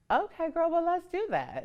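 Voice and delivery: put-on high, sing-out girlfriend reply; game agreement. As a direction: take up the dare in a high voice. Acted eager reply to the girls.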